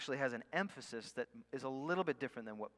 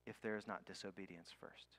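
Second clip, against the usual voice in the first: whispered point; 5 semitones lower, 9 dB quieter than usual. Acted. Sermon point dropped low.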